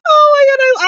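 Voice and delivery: high, loud fan squeal; squealing delight. Real, not acted. Fan delighted by cute scenes, loud and high. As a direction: burst out in joy at the couple. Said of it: "I got my life".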